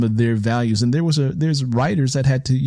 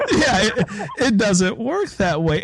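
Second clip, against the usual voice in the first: words through a laugh, higher up; 9 semitones higher, slower than usual. Real, not acted. Guest laughs while admitting writing takes work. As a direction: laugh it off, then say it.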